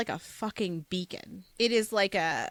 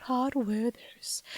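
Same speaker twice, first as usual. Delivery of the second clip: slow, whispery British ad tagline; hushed, drawn-out British-Southern flourish; British-Southern accent; much slower than usual. Pretend. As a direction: breathe out the product name in mock British. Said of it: "Southern British accent".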